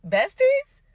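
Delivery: high, loud squeal of fake delight; mock excitement. Bride mocks the rival's sudden closeness, fake-thrilled. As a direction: squeal it like a sarcastic new best friend. Sarcastic question about rival.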